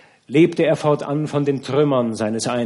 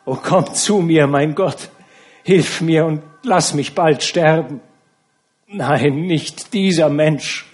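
loud pleading prayer, higher; anguished plea; 4 semitones higher, 5 dB louder than usual. A narrator's reading. The count begs God to let him die, anguished. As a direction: beg God to take him.

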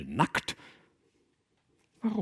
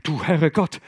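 loud startled cry to God; exclaimed; 4 semitones higher, 7 dB louder than usual. Acted. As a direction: cry out in shock. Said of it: "rief er".

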